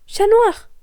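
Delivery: high, loud call across the rooftops; a high ringing call. Acted. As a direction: shout his name to get his attention. Ladybug calls out to him.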